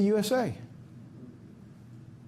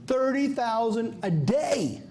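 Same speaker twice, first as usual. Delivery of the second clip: high, slow, each word stressed; slow emphatic; 6 semitones higher, much slower than usual. Real, not acted. Preacher stressing the impossible retrofit figure, slow and emphatic. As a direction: spell out the absurd number slowly.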